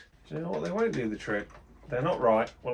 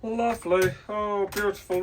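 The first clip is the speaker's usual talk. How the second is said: delighted; 8 semitones higher, 5 dB louder than usual. Real, not acted. Pleased with brake work.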